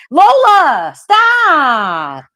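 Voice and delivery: loud, high call to stop; sharp shout. Real, not acted. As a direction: yell the name, then stop. Yelling at dog to stop.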